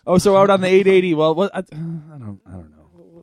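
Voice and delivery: a hint of a radio traffic reporter losing his thread; lightly voiced confused traffic announcer; mock traffic reporter. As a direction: start loud and high like a radio traffic report, then trail off lost. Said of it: "a traffic report where they just kinda lose track".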